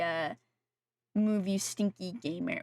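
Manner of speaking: ordinary talk, nothing marked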